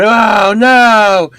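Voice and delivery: loud, high wail of dismay; hammed-up horrified cry; mock dismay. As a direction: cry it out in exaggerated dread. Acting out horror at arrival.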